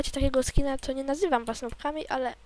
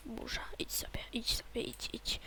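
very soft, whispered repeated plea; whispered coaxing; 12 dB quieter than usual. Real, not acted. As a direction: whisper the storm away. Quietly coaxes the storm away. (szeptem, zaklinając burzę)

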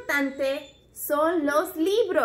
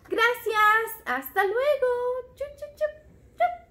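higher goodbye ending in kiss sounds; high puppet goodbye; 9 semitones higher, 3 dB louder than usual. Pretend. Toy teacher saying goodbye to the children, high puppet voice with kisses. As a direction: wave bye and blow kisses.

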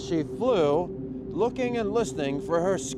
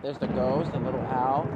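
ordinary delivery, nothing marked

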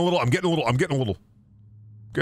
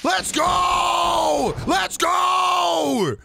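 hyped yell; much slower than usual. Real, not acted. Hyped cheering again.

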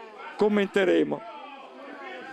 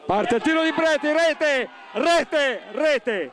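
breathless italian goal commentary; 7 semitones higher, slower than usual. Real, not acted. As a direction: shout the goal as it goes in. Goal called live.

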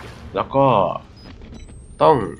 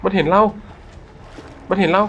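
alarmed; 7 semitones higher than usual. Real, not acted. Spotted by enemy.

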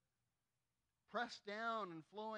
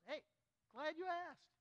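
eager; 10 semitones higher, 3 dB louder than usual. Retold or imitated. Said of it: "dad answering son".

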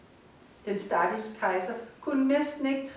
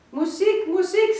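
high, loud cry for music; dying emperor screaming for music, high and loud; desperate scream; 9 semitones higher, 8 dB louder than usual. Acted. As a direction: shriek it out in panic. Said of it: "skreg kejseren".

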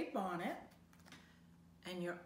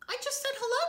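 much higher, louder bright exclamation; exclaiming; 7 dB louder, much faster than usual. Acted. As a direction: pop it out with innocent delight. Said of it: "Dory exclaimed".

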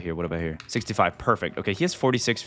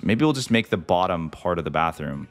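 ordinary delivery

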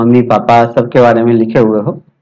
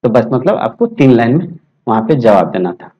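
usual speaking voice

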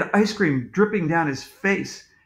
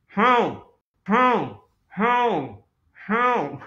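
higher-pitched muffled call for help; muffled cry; 5 semitones higher, much slower than usual. A narrator's reading. Trapped man calls from the roof, muffled. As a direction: call out as if muffled, in separate bursts. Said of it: "It was a muffled voice".

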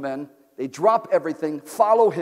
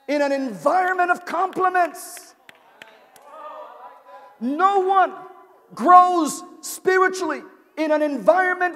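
full-force preaching; 9 semitones higher, 7 dB louder than usual. Acted. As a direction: preach it hard to the back row.

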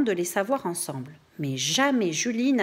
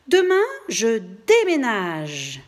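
firm announcement; 3 semitones higher, 6 dB louder than usual. Acted. Julie announcing she's moving.

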